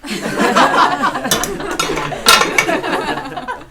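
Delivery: breathy giggle; high airy laugh. Real, not acted. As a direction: laugh it off lightly. Laughing at own joke.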